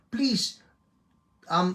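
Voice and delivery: normal talking voice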